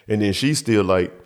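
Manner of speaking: ordinary delivery, nothing marked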